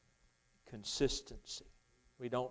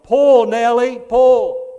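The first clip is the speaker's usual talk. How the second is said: loud, high yell at a horse; 11 semitones higher, 22 dB louder, much slower than usual. Retold or imitated. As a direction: call it across the field. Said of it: "and he yelled".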